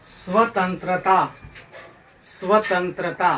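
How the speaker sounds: स्वतंत्रता slowly, स्व-तंत्र-ता; careful pronunciation. Shown as a demonstration. Teacher modelling स्वतंत्रता for pupils, slow careful pronunciation. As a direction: separate each cluster in स्वतंत्रता. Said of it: "इसका उच्चारण करिए".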